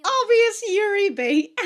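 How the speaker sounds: high, loud, slow delight; gleeful exclaim. Real, not acted. Fan calling out the obvious pairing, high, loud and slow. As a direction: savor every word like a fan.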